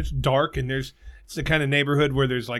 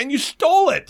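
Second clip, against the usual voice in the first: indignant; 10 semitones higher, 3 dB louder than usual. Real, not acted. Indignant rant.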